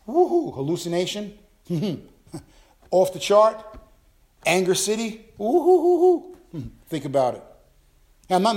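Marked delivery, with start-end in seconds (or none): 0.1-0.5 high, loud mock whoop. Pastor mocking dad's spiritual fever with a whoop. 5.4-6.4 high, loud mock whoop. Pastor mocking dad's spiritual fever with a whoop.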